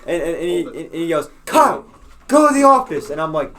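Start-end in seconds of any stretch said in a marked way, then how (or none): 1.5-3.1 loud, raised order to a student; yelling principal. Retold or imitated. Principal sends student to the office, yelling. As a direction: bark it across the room as an angry principal. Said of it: "he yells".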